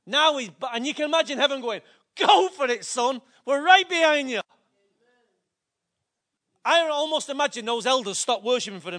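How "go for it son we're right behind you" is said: cheering. Pretend. Imagined heaven cheering David.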